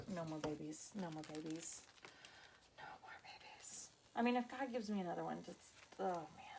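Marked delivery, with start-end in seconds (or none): 0.1-1.8 softer, firm repeat of no more babies; quiet insistence. Real, not acted. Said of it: "repeats, fading". 2.8-3.9 breathy whisper trailing off. Real, not acted. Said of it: "repeats, fading to whisper".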